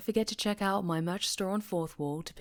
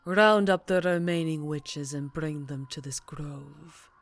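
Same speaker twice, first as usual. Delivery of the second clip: sinister sorcerer; slower than usual. Acted. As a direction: purr every threat like a gloating dark lord. Villain addresses captive witch.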